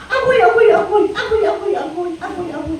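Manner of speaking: very high, hammed-up yelping; mock pain cries. Preacher acting out itching pain nobody saw, mock high yelps. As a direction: yelp in mock agony, fading as you go.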